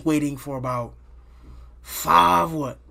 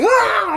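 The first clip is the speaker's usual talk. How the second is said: loud, high cry of pain; retold pain scream; 11 dB louder than usual. As the paramedics let his arm go, a loud, high retold scream. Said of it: "I get to screaming".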